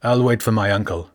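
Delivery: ordinary delivery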